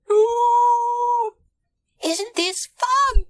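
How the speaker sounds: very high, delighted squeal; squeaky-high excited character; thrilled. A narrator's reading. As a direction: gush with delight on a boat trip. Bonnie delighted on the deck.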